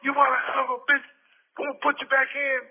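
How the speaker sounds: angry Jerry. Retold or imitated. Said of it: "he was so mad".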